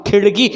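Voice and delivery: खिड़की as "khiṛki", retroflex flap; flapped ड़. Shown as a demonstration. Teacher repeats what was said, खिड़की with flapped ṛ. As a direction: make the ड़ in खिड़की a quick tongue flick. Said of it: "मैंने क्या बोला?".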